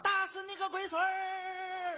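loud, high Henan-dialect battle shout, last sound drawn out; 河南话版史泰龙. Acted. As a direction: yell it slowly in broad Henan dialect. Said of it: "史泰龙端着枪". (河南话拖长音高声喊)

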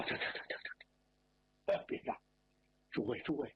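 a breathless hushed plea to stop shouting; flustered hushed stammer. Acted. Father stammering to hush the angry relatives, hushed and flustered. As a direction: stammer it almost voicelessly, trying to hush a room.

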